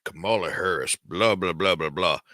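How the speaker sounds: lightly mocking an old cowboy actor's low voice; Sam Elliott. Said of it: "where Sam Elliott's like".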